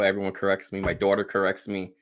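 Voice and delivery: usual speaking voice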